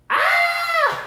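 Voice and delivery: very high, loud squeal of delight; a gleeful shriek at the funny glasses. Real, not acted. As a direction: let out a sudden happy scream.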